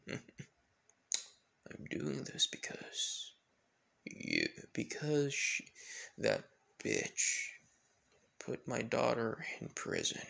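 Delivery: very soft, slow confession of a grudge; hushed, drawn-out villain voice; menacing villain. Acted. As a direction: let it seethe out quietly, with long pauses.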